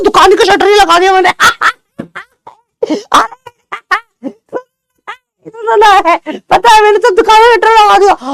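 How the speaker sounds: high, put-on auntie voice, chattering; gossipy auntie. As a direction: play a nosy auntie who hid behind the shutter, high. (ऊँची आवाज़ में गपशपी aunty)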